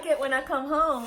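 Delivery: ordinary talk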